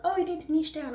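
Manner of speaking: high, sing-song mock know-it-all; mocking advice. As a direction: parody the nagging advisers.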